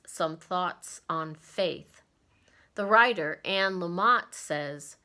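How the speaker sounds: ordinary talk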